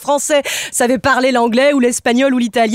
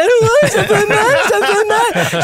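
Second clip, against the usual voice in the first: mock whining child; 8 semitones higher, much slower than usual. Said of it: "il était là".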